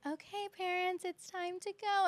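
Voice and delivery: high announcing-to-parents voice; imitated classroom dismissal voice; teacher's polite send-off.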